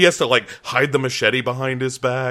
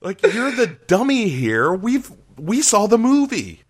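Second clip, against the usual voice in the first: exasperated; 8 semitones higher, slower than usual. Retold or imitated. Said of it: "you're like".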